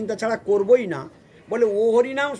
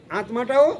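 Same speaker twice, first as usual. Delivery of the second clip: usual speaking voice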